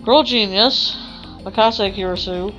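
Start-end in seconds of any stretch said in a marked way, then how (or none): none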